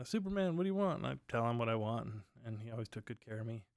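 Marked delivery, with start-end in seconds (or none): 0.0-1.0 high, cheery bartender call across the bar; bartender greeting. Retold or imitated. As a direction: sing out his name like an eager bartender. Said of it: "he'd be like".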